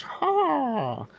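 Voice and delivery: shrill high crow yelling the news, hammed up; crow announcer voice. Imitating the messenger crow announcing their defeat, high and loud. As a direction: squawk it out loud like a bird herald.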